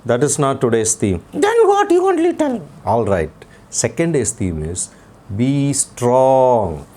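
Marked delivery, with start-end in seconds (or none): 1.3-2.6 put-on puppet boy voice. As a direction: play the cheeky little boy puppet. Puppet child voice.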